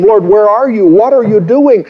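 a retold plea, half relived; anguished prayer. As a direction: cry out to a God who seems gone. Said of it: "when I cried out and I said to him".

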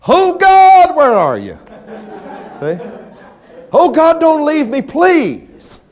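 high, loud, wailing cry to God; mock desperate prayer. Preacher parodies Christians wailing that God left them. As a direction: ham up a lost soul crying out.

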